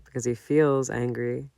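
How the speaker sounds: ordinary delivery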